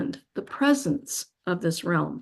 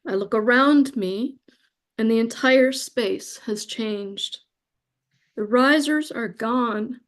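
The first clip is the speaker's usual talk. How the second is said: normal talking voice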